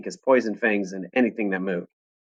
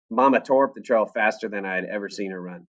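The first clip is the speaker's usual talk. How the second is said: plain reading voice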